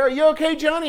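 high, loud worried call; mom yelling. Retold or imitated. Mom calling to her son after a fall, high loud yell. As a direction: call out across the field. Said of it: "she yelled out there".

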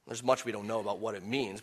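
ordinary talk

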